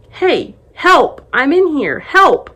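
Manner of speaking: loud, high desperate shout; shouting to people outside a sealed tomb, trapped and desperate; shouting for help. Acted. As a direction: yell for help through the stone, full force. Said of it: "I shouted so loudly".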